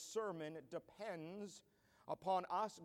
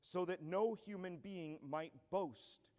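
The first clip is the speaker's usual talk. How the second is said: scripture reading; 5 dB louder than usual. Acted. Preacher reading Paul's letter to the congregation, building force. Reads 1 Corinthians aloud.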